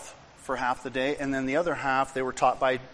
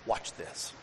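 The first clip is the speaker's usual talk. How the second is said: whisper; 5 semitones lower, 9 dB quieter than usual. Retold or imitated. Cindy whispers to the storyteller.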